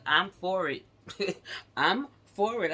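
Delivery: cheerful laughing agreement. Real, not acted. Laughing approval of good luck.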